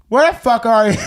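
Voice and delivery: loud furious demand down the phone; shouting where are you on the phone; angry yell. Retold or imitated. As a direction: yell it like a drunk furious friend. Drunk friend calling angrily.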